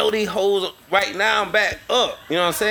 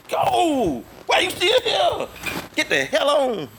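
play-acted 'be gone' holler; mock exasperated dismissal; 11 semitones higher than usual. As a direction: act out throwing someone out, voice pitched way up. Acting out sending his wife away.

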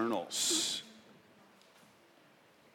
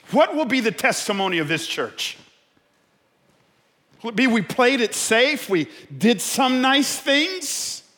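loud, raised, challenging sermon delivery; impassioned preaching; 12 semitones higher, 8 dB louder than usual. Real, not acted. Preacher asks the church about its testimony, impassioned. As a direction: challenge the congregation head-on.

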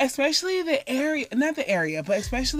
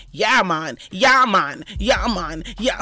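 mock Jamaican greeting; 5 semitones lower, 4 dB louder, slower than usual. Jamaican woman mocking how people greet her, lower and louder. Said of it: "everyone saying".

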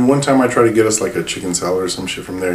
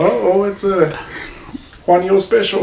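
mock excited; 11 semitones higher than usual. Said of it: "they're all".

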